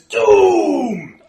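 high, loud, long cry falling away; creature's doom cry. Acted. Creature points at the player, ominous shout. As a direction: proclaim it hugely as a dire warning. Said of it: "he points at you and he says".

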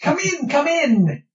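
high, excited little creature; kobold voice. Acted. Kobold greets the party.

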